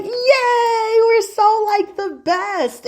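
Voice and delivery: high, teary cheer; mock crying with joy; mock teary squeal. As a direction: cheer like a gushing teen. Virgos celebrating each other.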